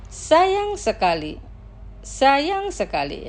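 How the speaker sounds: Sayang sekali, slowly, with the stress on Sayang; slow pronunciation. Shown as a demonstration. Teacher models Sayang sekali slowly for listeners, slow pronunciation. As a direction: drawl Sayang sekali out for students. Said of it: "Los pronunciaré lentamente".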